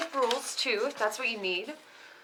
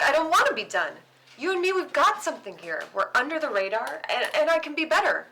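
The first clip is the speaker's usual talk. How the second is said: desperate pleading; 4 semitones higher, 6 dB louder than usual. Acted. Pleading not to end partnership.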